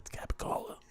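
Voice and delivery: hushed, whispered prompt; whispered aside. Retold or imitated. Pizza guy quietly tipping off the new driver, whispered aside. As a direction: whisper it like a discreet tip.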